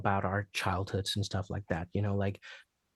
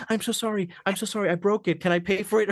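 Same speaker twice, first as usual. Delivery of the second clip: sheepish apology; 8 semitones higher, 3 dB louder, faster than usual. Retold or imitated. Sweet kid apologizing for breaking rope.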